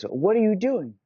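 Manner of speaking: a blacksmith calling out a question. Acted. Blacksmith asks Timothy.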